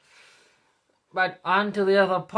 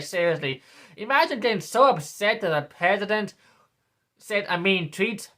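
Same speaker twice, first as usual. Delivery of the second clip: normal talking voice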